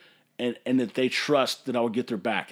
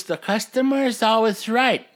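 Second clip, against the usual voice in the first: higher, louder mock voice on the cliché; mocking slogan; 7 semitones higher, 4 dB louder than usual. Brewery owner mocking the customer-is-right rule, higher mock sing-song. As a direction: sneer the slogan like a silly catchphrase.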